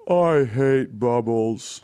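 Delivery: killjoy brothers. Acted. Co-host mimics buzzkill brothers in a hot tub, glum drone. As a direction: drag it out like a joyless buzzkill. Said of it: "they're just like".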